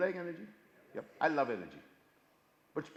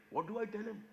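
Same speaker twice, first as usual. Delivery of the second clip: ordinary talk, nothing marked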